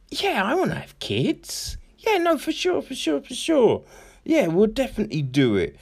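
high, breezy, insincere yes-yes; fake eager agreement. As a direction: promise it brightly without meaning it. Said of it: "you're telling the partner".

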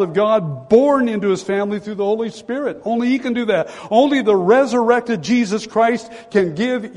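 fervent pulpit delivery; preaching at full force. Acted. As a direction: lift the congregation, preach it high. Preaching climax, raised voice.

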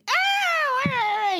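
very high imitated wail; mock kid wail. Parent imitating kids mid-tantrum, mock high wail.

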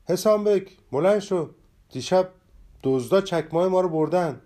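loud, high shout; shouting to wake his friend. A narrator's reading. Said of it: "فریاد زد".

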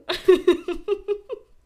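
loud high real laugh; burst of laughter. Real, not acted. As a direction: crack up laughing at your own joke. Laughs at her own crude joke. (éclat de rire aigu et fort)

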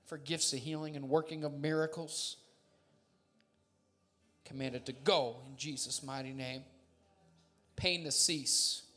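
loud commanding healing prayer, preached; laying a curse. Acted. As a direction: cast out sickness with full force. Prayer for healing, rebuking illness.

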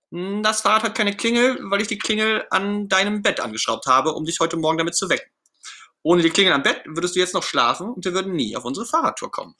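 high, insistent character voice; Ernie, justifying himself. Acted. Ernie explaining to Bert why the bell is on the bed, justifying himself. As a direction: explain it all in a sustained high voice.

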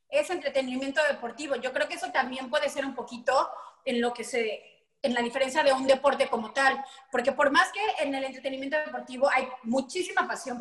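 ordinary talk, nothing marked

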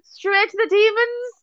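very high, laughing incredulity; cracking up. Real, not acted. Telling friend about the exorcism suggestion, cracking up. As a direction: echo it in a squeal of amusement.

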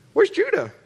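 high, sharp "Where's Judah?"; wife asking. Retold or imitated. Wife asks where son is.

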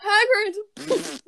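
high loud call across the room. Real, not acted. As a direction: shout the nickname out. Calling out the nickname.

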